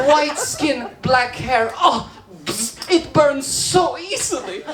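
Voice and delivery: high-pitched, delighted cooing; gushing technician. Acted. Acts delighted laser technician.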